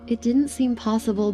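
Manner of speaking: ordinary delivery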